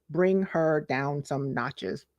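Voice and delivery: slow and deliberate, every word weighed; slow, together. Shown as a demonstration. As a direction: draw it out so they say it with you. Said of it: "say it with me".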